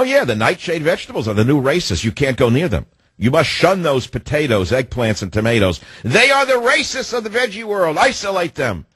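high-pitched mock rant, climbing to a peak; mock alarm. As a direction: cry out in absurd, exaggerated outrage. Mock alarm about vegetables.

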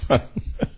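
quick airless chuckle; short silent laugh; breathy laugh. Real, not acted. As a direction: laugh under the breath at the joke. Laughs at own joke.

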